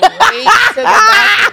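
high, loud, slow laughing wail; laughing half-cry. Real, not acted. Punchline of the beans story told to friends, laughing wail.

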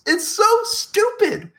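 high-pitched incredulous rant; exasperated outrage. Real, not acted. Co-host fed up with the catchphrase, exasperated. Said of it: "It got me so mad".

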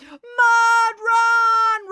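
very high, long held chant; crowd roar. A narrator's reading. Crowd answering Brad, roaring. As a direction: roar it as one excited crowd. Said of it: "roared the crowd".

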